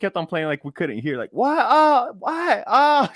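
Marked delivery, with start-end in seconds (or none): 1.3-3.1 high, loud "what?" calls; exaggerated shouts, pitched way up; mock shouting. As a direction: holler it like you can't hear. Mimicking shouting over loud noise.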